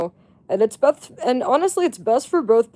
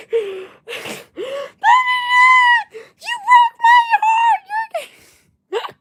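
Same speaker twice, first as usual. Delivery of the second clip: very high, slow, sobbing shriek; mock wailing accusation; 5 dB louder, much slower than usual. Imitating the friend wailing at her boyfriend, very high. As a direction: wail it like a dumped girlfriend.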